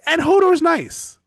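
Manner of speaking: high, loud and laughing plea; sweet pleading maester. Retold or imitated. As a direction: beg sweetly for Hodor, laughing. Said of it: "it's like".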